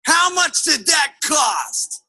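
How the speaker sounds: exasperated husband, word by word; negative husband. Acted. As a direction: draw out an indignant question. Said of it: "her big fat negative husband".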